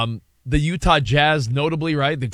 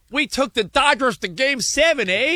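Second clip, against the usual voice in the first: higher-pitched mock Canadian with a tagged-on eh; Canadian impression; 9 semitones higher than usual. Host imagining Blue Jays fans' moral victory, mock Canadian. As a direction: play a proud Canadian fan. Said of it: "They're, they're Canadian".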